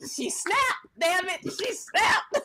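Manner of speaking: high-pitched, incredulous what; mock shocked outburst. Friend imagining phoning in the news, mock shock. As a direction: squeal it in pretend shock, then stretch it out. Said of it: "I was like".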